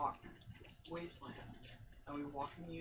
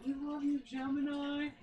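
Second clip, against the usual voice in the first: higher, louder imitation of a cheering shout; retold yell; 10 semitones higher, 5 dB louder than usual. Moth Eater yells to the veiled sisters walking by, mock yell. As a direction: call out like a supporter cheering passers-by. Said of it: "he yells out".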